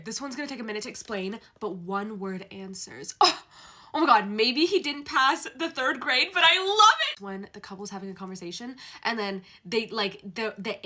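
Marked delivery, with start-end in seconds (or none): 3.2-7.2 high loud rising gush; excited gush. Real, not acted. Fan gushing about trope, excited. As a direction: gush with joy.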